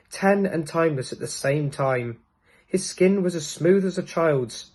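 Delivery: ordinary delivery, nothing marked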